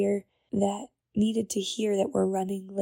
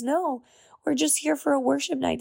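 higher, eager friend's voice; friend's excited voice; 5 semitones higher than usual. Retold or imitated. Yvette explaining her group's worship night, higher eager voice. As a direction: play the cheerful friend explaining.

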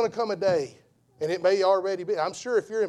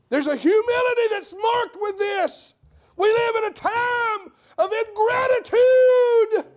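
high, loud preacher's shout; preaching at full force; 13 semitones higher, 5 dB louder than usual. Acted. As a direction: thunder it from the pulpit.

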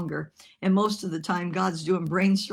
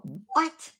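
high, rising incredulous what; disbelieving surprise; 4 semitones higher than usual. Retold or imitated. Preacher recalls hearing her sermon was replaced, incredulous. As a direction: react as if you can't believe it. Retold stunned reaction to the change.

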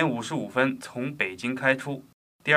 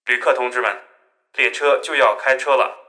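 formal announcer voice, a bit louder; train announcement; 5 dB louder than usual. Acted. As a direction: address the passengers like a train PA.

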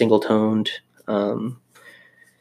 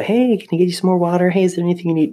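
higher, perky imitation of a hovering waiter; mock attentive server; 9 semitones higher, faster than usual. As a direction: play an overeager server checking in. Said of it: "They don't go".

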